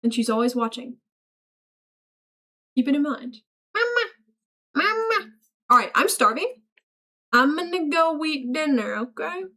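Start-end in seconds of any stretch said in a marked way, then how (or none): 3.8-5.3 high-pitched little mama cry; playful mama call. Pretend. Playful child-like calling out.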